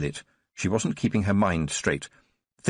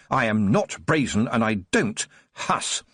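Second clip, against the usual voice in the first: plain reading voice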